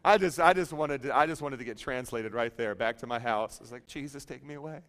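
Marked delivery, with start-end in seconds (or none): 3.9-4.8 soft, small mock prayer; hushed imitation of an embarrassed plea; mortified plea. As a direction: beg quietly to disappear.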